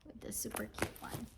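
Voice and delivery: whisper. Real, not acted. Aside while showing bag.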